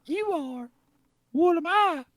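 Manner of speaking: very high mock voice; put-on woman's impression. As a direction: squeak it high, still in character. Continuing the Natalie impression.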